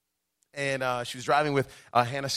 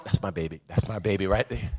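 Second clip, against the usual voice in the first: proud parent; 7 semitones lower, 6 dB quieter than usual. Retold or imitated. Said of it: "see a parent going".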